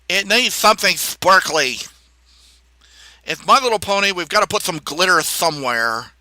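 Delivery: mock sparkly gushing; mock camp voice. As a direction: gush over the glitter in a put-on camp voice. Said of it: "talk like that".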